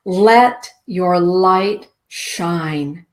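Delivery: slow, deliberate blessing; very slow, drawn out. Shown as a demonstration. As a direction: let your light shine stretched out very slowly. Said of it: "the message of the unicorn is this".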